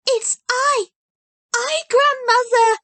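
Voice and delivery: put-on high, loud girlish cry of joy; joyful cry. A narrator's reading. Heidi bursting in on her blind grandmother, high joyful cry. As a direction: burst out with happiness. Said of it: "cried Heidi".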